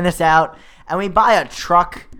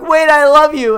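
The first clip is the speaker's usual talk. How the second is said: mock lovelorn cry, high and loud; desperate calling out; 10 semitones higher, 10 dB louder than usual. Jilted rescuer calling as she runs away, mock-desperate. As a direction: call after a runaway, hammed-up pleading.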